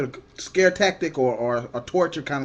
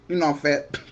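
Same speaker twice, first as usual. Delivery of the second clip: normal talking voice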